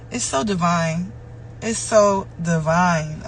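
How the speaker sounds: "It's so divine" stretched out very slowly; drawn-out, reverent "so divine"; slowed, savoring. Real, not acted. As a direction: let each word of "It's so divine" linger. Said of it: "repeated phrase".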